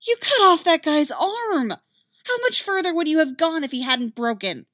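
high, loud outrage; furious. Acted. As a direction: shout in disbelief. Ahsoka furious at Ventress.

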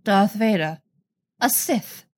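mildly sharp questioning. A narrator's reading. Jocasta questioning the Chancellor, mildly sharp. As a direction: cut the name short, with a touch of sharpness. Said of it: "Jocasta says sharply".

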